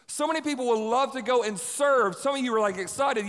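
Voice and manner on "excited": ordinary delivery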